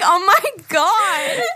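high, drawn-out laughing exclamation; mock disbelief. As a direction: stretch it out in amused horror. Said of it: "I'm like".

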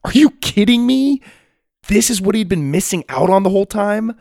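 high, loud, amazed rush; thrilled disbelief, voice jumping up; excited. A narrator's reading. Jake amazed at the arena.

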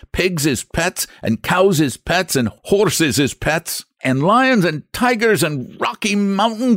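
high, stubborn Irish brogue; an Irish expressman laying down the law; Irish accent. Acted. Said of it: "my dreadful Irish accent".

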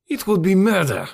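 high-pitched, horrified refusal; appalled protest. A narrator's reading. Decoud refuses to shoot Tarzan.

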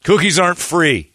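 normal talking voice

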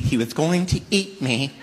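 ordinary talk

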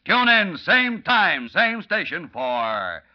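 rising, loud serial cliffhanger; old radio announcer. Acted. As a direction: hype the next episode big. Announcer closes episode.

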